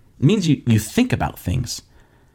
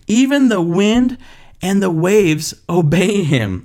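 slow, higher-pitched awe; amazed; 5 semitones higher, slower than usual. Acted. Disciples marvelling at the calmed storm, slow amazement. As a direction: draw it out in acted wonder. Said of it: "The disciples were amazed".

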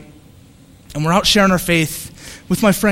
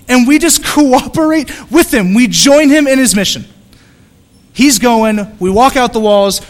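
louder, higher preaching build; forceful preaching; 6 semitones higher, 7 dB louder than usual. Acted. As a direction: drive it home to the congregation.

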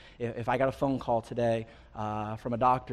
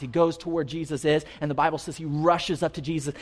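ordinary talk, nothing marked

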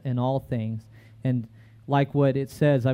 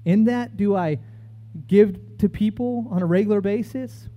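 preaching at full force; 7 semitones higher than usual. Acted. Preacher testifying to his congregation, fervent and soaring. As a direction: lift the sermon to its peak.

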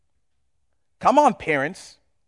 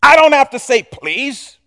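loud, high, self-important VP barking orders; loud bossy executive shouting for coffee; arrogant boss; 6 semitones higher, 7 dB louder than usual. Acted. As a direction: lord it over the assistant, demanding. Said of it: "bossy VP to the admin".

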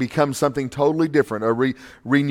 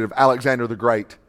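ordinary talk, nothing marked

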